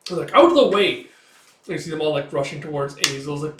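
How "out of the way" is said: high, loud command; forceful shout. Retold or imitated. Attackers rush toward their target, forceful shout. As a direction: order people aside. Pushing past in battle.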